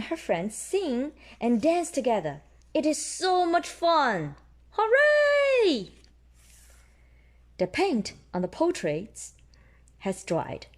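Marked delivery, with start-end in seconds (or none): 4.8-5.9 high, loud hooray; high loud cheer. Acted. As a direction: cheer like a happy crowd of kids. Children cheering in story.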